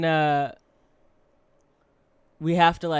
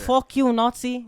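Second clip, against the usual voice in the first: loud mock German accent; 9 semitones higher, 8 dB louder, slower than usual. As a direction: shout it in a hammy German accent. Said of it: "he could do a funny German accent".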